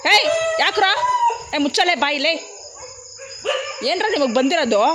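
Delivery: yell at the dogs. Real, not acted. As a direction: bark back at them to shut up. Yelling at barking dogs.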